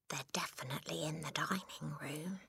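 hushed, secretive whisper. Acted. Matilda warning family about the dining room, whisper. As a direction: whisper it under your breath. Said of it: "Matilda whispered".

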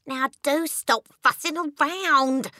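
high, drawn-out wifely complaint; exasperated wife. A narrator's reading. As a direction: tell him off wearily from the pillow. Wife annoyed at restless husband.

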